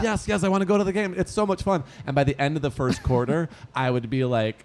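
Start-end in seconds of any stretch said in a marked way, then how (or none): 0.0-1.8 put-on eager kid. Childhood self, eager.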